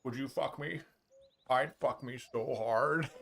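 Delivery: hammed-up seduction, leaning in; sultry come-on voice. Boss teasing a stunned new hire, mock seductive murmur. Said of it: "he was going like this".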